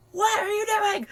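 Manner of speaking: very high, loud panicked shout; panicked yell at the man by the truck; freaking out. Retold or imitated. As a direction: scream it in terror at the figure. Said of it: "freaking out".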